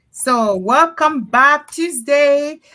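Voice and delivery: high, loud, slow call of welcome. Real, not acted. Host greeting returning viewers, loud excited welcome. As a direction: call it out to the whole audience.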